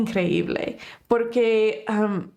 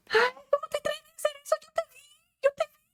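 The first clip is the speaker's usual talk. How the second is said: very high, fast mock-angry squeal; angry girlfriend; 3 dB quieter, much faster than usual. Girlfriend confronting her boyfriend over another girl, mock angry. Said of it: "la muchacha se enoja".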